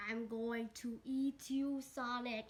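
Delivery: slow, drawn-out threat; mock menace. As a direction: threaten slowly, playing the monster. Bird threatens to eat Sonic.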